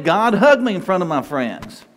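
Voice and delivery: earnest invitation, "hug me" said openly and gladly; sincere plea. Shown as a demonstration. Preacher showing how to welcome God's love, sincere. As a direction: offer it up to God as a heartfelt request. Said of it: "Go ahead".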